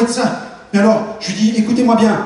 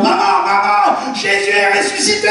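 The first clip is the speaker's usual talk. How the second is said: excited shouting; 11 semitones higher than usual. Retold or imitated. Son bursting in shouting to his mother, much higher. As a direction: burst in calling out joyful news. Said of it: "j'arrive en hurlant, en criant".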